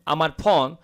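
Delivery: normal talking voice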